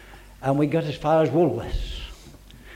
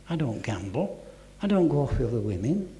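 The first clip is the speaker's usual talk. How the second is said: usual speaking voice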